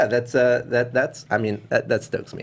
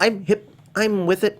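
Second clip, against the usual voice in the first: higher, smug villain voice; Doctor Evil; 8 semitones higher, slower than usual. Retold or imitated. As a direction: play it like a smug supervillain. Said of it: "You know Doctor Evil?".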